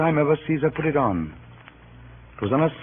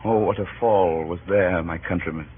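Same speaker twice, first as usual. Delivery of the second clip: low and fading with sorrow; mournful lament; 9 semitones lower than usual. Acted. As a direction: mourn the fall, letting it sink. Lament over Caesar's fall.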